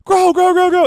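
loud, very high repeated urging; rapid-fire mock shout at a plant; frantic command. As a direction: imitate her frantically willing it on. She keeps going like.

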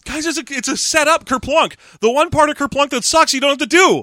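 high-pitched mock delight; excited soldier. Acting soldiers delighted by the game.

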